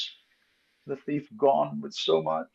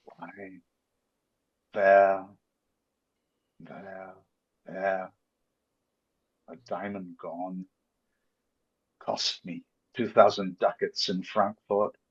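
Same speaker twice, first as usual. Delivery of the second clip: lower, heavy-hearted grief; low lament; 9 semitones lower, 6 dB quieter than usual. Acted. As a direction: moan over the loss. Shylock laments lost diamond.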